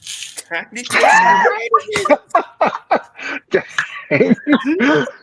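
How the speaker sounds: loud shrieking laugh. Real, not acted. Big laugh at the joke.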